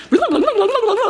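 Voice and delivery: high, loud excited babble with a laugh; mock the bankers' jargon chatter; giddy gibberish imitating excited bankers. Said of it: "speaking their weird banker language".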